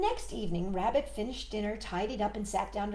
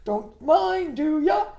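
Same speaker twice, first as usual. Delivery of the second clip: much louder, higher, laughing frog; a frog thrilled to show off his family; excited frog; 7 semitones higher, 14 dB louder than usual. Acted.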